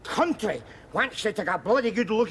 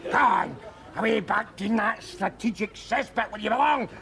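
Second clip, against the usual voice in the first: normal talking voice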